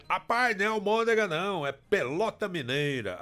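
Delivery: quoted listener. Retold or imitated. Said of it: "falou assim".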